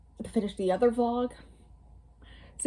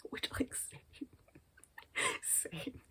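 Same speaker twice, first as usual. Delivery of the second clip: breathy laughing whisper of a joke; a giggly near-silent aside; 14 dB quieter, much slower than usual. Real, not acted. Whispering a joke to her viewers while laughing.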